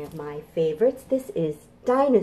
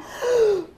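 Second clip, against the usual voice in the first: high sharp gasp; excited gasp; 3 dB louder than usual. Acted. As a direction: gasp in suspense. Dinosaur jumps.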